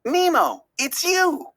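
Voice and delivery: joyful surprise. A narrator's reading. Dory recognizes Nemo.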